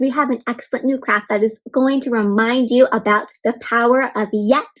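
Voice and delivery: ordinary delivery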